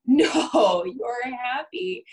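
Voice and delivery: shouted no, then a drawn-out, slow, high you're happy; mock insistent denial. Therapist parodying denying an angry client, loud mock insistence. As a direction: overrule them, hammed-up and insistent. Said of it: "And me going".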